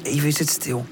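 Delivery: ordinary delivery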